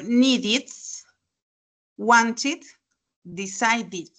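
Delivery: needed, wanted, decided with -ed as an extra "id" syllable; ID endings. Shown as a demonstration. Teacher models needed, wanted, decided, ID endings. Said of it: "I D".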